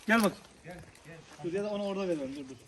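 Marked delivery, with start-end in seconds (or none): 0.1-0.3 calling out. Real, not acted. Calling dogs loudly while feeding.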